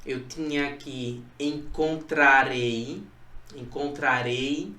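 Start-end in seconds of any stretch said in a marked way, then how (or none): 1.4-4.7 slow model reading. Shown as a demonstration. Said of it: "Olha aqui, exemplos de futuro do presente".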